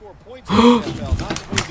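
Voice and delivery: sharp, high gasp; surprised gasp. Real, not acted. Streamer sees his lagged-out game return, high surprised gasp. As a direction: gasp in sudden relief.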